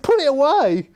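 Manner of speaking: pinched high command; John Weller's accent. Retold or imitated. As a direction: shoo the cash back into the case. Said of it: "I can't do the accent properly now".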